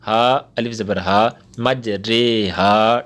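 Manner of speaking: letters spelled slowly one by one; slow hija recitation; slow spelling out. Shown as a demonstration. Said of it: "را امالہ والی کیا کہ رے کر دیں گے".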